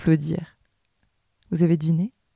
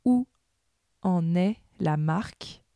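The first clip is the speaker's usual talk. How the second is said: dictating; 6 dB quieter than usual. Acted. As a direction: read it out like a school dictée, word by word. Dictée read aloud with punctuation.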